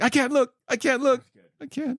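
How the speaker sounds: high mock-scared squeal; mock fright. Joking about a viewer scared by Karloff's intro, mock high fright. Mocking scared audience member.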